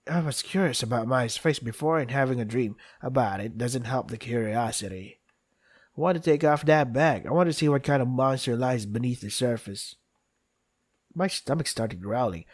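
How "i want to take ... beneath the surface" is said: usual speaking voice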